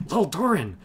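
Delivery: name blurted high in alarm; shocked gasped name. A narrator's reading. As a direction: Torrison horrified at the secret spilled. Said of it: "Torrison gasped".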